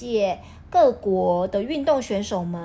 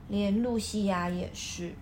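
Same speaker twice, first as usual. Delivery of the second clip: ordinary delivery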